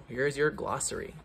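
ordinary delivery, nothing marked

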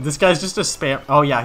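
ordinary delivery

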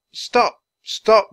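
loud, high calls to halt; urgent command. A narrator's reading. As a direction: shout at a runaway animal to stop. Boy orders the elephant to stop.

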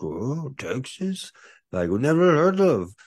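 high, puzzled voice rising to loud; islanders puzzled. Retold or imitated. Micronesian men who never heard of Texas, puzzled high voice. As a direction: play the confused locals who never heard of it. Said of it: "five or six of them were like".

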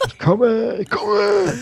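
high, urgent excitement; excited. Real, not acted. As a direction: call out, hurrying over. Rushing to see head.

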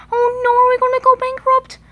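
high, fast, worried squeak; anxious toy voice squeaking in alarm; panicked. Acted. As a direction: fret in a rush. Panicking about money.

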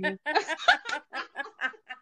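a burst of high, real laughter. Real, not acted. Laughing after her friend calls her mundial. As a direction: laugh it off, tickled and a little embarrassed.

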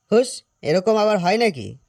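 usual speaking voice

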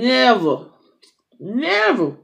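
"never" drawn out with a big pitch swell; emphatic stress. Real, not acted. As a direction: hit each "never" slowly and hard. Stressing something that never happened.

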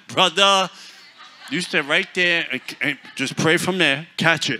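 loud, laughing shout to stay back; mock alarmed shout. Preacher shouting at the man to keep away, loud and laughing.

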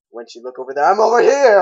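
loud, high teasing shout; playful taunt. Retold or imitated. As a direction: tease like a trickster. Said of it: "It's like".

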